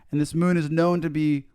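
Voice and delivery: ordinary talk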